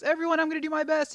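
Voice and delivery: hammed-up pep shout; mock rallying yell. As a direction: fire up the class with it. Retold shout to the class.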